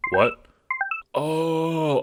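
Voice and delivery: startled sudden "what"; sharp jump up in surprise; surprised. Acted.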